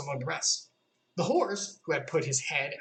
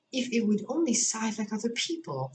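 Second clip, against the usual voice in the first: Alice voice; 7 semitones higher than usual. A narrator's reading. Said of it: "she thought".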